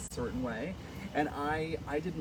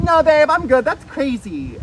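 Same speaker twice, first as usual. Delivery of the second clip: high, loud brush-off; incredulous refusal; 10 semitones higher, 15 dB louder, slower than usual. Retold or imitated. Recounting being asked to direct, loud incredulous refusal. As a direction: wave the offer away, loud and high.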